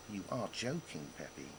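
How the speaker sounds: hushed, dreading voice; a soft, sinking plea of disbelief; mounting dread. Retold or imitated. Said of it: "I said, with mounting dread".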